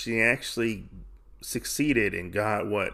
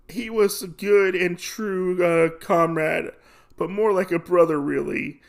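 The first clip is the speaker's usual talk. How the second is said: higher-pitched fake grief; lightly acted tearful lament; mock crying; 7 semitones higher than usual. Gambler mourning his comrade, half-hearted mock crying. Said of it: "He starts crying".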